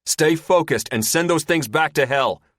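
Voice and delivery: high, commanding bark; loud order. Acted. Commander orders a panicking pilot over the comm, loud. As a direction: order him to hold it together. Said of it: "Porthos orders".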